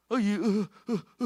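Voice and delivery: high, loud, slow panicked stammer; mock-anxious parent gasps; anxious flustered sounds. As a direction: flap like a nervous parent. Imitating parent hovering anxiously.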